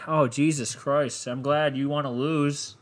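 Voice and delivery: usual speaking voice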